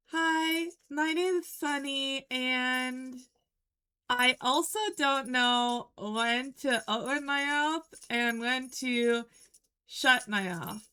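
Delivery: doll voice, lips still; ventriloquist voice. Shown as a demonstration. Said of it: "I can talk without moving my mouth".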